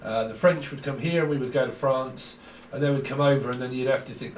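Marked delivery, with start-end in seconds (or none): none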